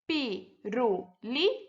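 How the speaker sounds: "Pirulito" slowly as four beats, pi-ru-li-to; syllable by syllable. Shown as a demonstration. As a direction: count out each syllable of "Pirulito" with gaps. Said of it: "quatro sílabas ou mais".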